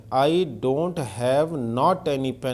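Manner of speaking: very slow, word by word, I don't have not any pen; slow dictation. Shown as a demonstration. Teacher reading a wrong sentence aloud very slowly. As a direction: spell out each word for the class.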